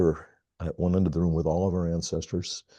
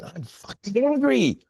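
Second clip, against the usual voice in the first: mock angry outburst. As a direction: act out the rage people voiced in the circle. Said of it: "you start saying".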